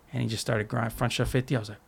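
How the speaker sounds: usual speaking voice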